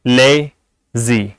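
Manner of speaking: Lazy broken in two, le, pause, zi; lazy in two separate beats; split into syllables. Shown as a demonstration. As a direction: say lazy syllable by syllable with a clear gap. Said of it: "इसमें दो syllable हैं".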